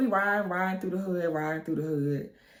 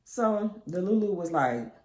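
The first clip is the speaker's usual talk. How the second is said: normal talking voice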